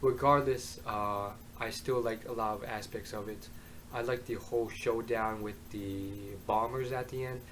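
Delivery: ordinary talk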